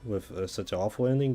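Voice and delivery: usual speaking voice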